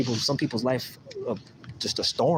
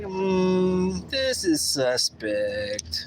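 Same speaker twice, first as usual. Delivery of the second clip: slow, drawn-out mock suspicion, pitched higher; a drawn-out, hammed-up suspicious hum; 8 semitones higher, much slower than usual. Imitating someone realizing a privacy breach, slow and suspicious. Acting out noticing something fishy.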